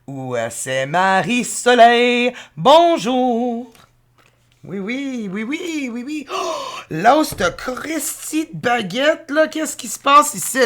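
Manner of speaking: high, hammed-up French babble; mock French. Joking over tarot cards, hammed-up mock French. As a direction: play a silly cartoon Frenchman.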